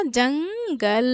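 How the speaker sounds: जंगल very slowly split, long ng in the nose; जंगल stretched syllable by syllable with nasal stress; nasal anusvar demo. Shown as a demonstration. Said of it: "जंग पढ़ने पे नाक पे बल जा रहा है".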